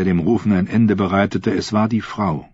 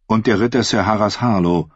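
ordinary delivery

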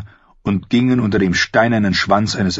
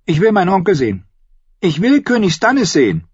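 put-on high, obstinate boy; high-pitched demand, digging heels in; stubborn; 12 semitones higher, 4 dB louder than usual. A narrator's reading. Boy demanding to see his uncle, stubborn. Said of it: "setzte er sein störrisches Gesicht auf".

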